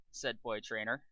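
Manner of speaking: ordinary delivery, nothing marked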